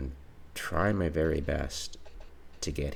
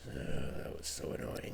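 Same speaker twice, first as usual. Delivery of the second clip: softer, breathed out, worn down; weary whisper; 7 dB quieter than usual. Real, not acted. Gamer muttering after a tedious rescue, weary whisper. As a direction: sigh it out under your breath.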